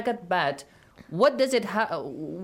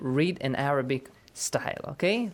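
ordinary talk

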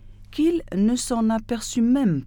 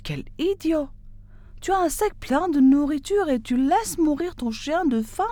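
higher, outraged scolding; indignant; 8 semitones higher than usual. A narrator's reading. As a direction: call him an idiot, indignant. (indigné, voix plus aiguë)

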